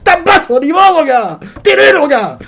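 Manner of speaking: very high, loud mock outrage; squealing shouted ridicule; incredulous jeering. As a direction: yell down a boastful fool. Imagined readers jeering at Baggy.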